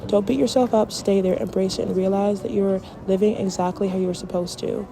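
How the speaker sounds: rally the viewers like a closing speech; motivational speech. Real, not acted.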